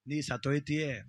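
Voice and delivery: soft, low ominous warning; quiet warning. Acted. Dasappa softly warns the widow she will die. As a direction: hush it like a dark secret.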